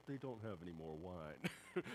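a mother quietly telling her son, soft and slow; gentle mom. Retold or imitated. Mary telling Jesus the wine ran out, soft and slow. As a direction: lay the problem down gently, like a mom to her boy. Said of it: "Jesus' mom just goes to him and she's like".

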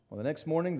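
ordinary talk, nothing marked

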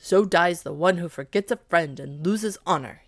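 loud, grave declaration over the body; cried sadly. A narrator's reading. The dog cries over the cat's body, loud and grave. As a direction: proclaim it loudly with sorrow. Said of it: "he cried sadly".

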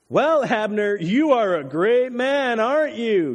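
loud, high, stretched-out taunt. A narrator's reading. David mocks the sleeping bodyguard Abner, taunting loudly. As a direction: goad him with mock praise. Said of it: "David taunted".

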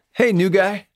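a high, teasing imitation of the bass player; imitating Craig. Bass player greeting him.

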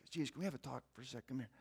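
quick, hushed pull-aside; low and quick, like a friend taking him aside for a word. Retold or imitated. Peter pulls Jesus aside.

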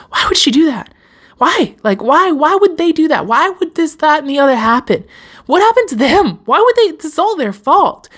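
high, incredulous whining why; acting out a hurt, blaming inner voice; indignant blame. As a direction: pitch up and protest like it's all their fault.

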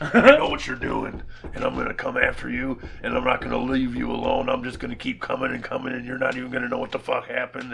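lightly voiced tough-guy threat; a hint of The Rock; playful mock menace. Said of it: "doing a little rock impression".

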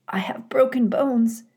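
high, hurt, whispered rasp. Acted. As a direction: rasp it out in pain. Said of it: "she rasped".

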